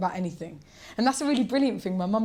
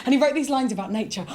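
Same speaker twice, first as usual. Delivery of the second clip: ordinary delivery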